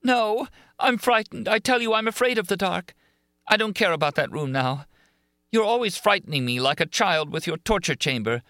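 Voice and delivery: anguished. A narrator's reading. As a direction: beg him out of fear. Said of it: "Christine's voice of anguish".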